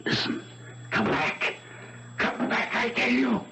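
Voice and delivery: hushed, urgent call; urgent whisper. Acted. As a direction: whisper it tensely so no one hears.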